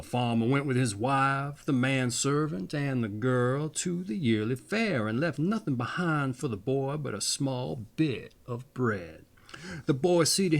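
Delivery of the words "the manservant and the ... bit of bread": ordinary delivery, nothing marked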